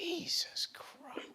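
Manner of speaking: slow, voiceless whisper; appalled whisper. Real, not acted. Host reacting to the bloody horse lung, appalled whisper. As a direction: breathe it out slowly in stunned horror.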